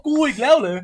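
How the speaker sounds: quoted complaint, high and loud; acted incredulous protest. Retelling the hounded player's complaint, incredulous protest. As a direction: exclaim it in mock disbelief. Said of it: "ปิจูบอก".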